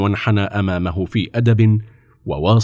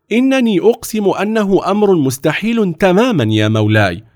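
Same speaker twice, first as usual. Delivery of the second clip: heated, higher-pitched protest; vehement objection; 6 semitones higher than usual. A narrator's reading. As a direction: argue it insistently, rising to an emphatic oath. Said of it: "كان محتدا جدا".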